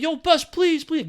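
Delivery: high, pleading call to the bus. Retold or imitated. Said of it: "I'm like".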